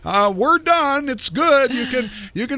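high, strained call-out at no great volume; mock screaming. Rider calling down to the carny to stop, mock scream. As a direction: plead up high to someone below, mock panic. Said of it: "screaming out to the carny".

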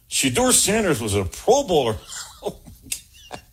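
cracking up mid-sentence; laughing. Real, not acted. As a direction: laugh through the words. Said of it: "I can't say that with a straight face".

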